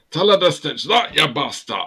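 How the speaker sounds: loud, high Glaswegian outburst; Glaswegian accent. Shown as a demonstration. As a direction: belt it out in mock Glaswegian. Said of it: "maybe in a Glaswegian accent".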